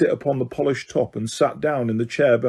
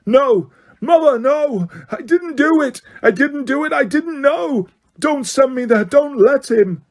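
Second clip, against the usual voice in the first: much higher, panicked screaming pleas; 12 semitones higher than usual. A narrator's reading. Son to his mother as sentence falls, panicked screaming. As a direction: scream as the guards close in. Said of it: "The boy began to scream".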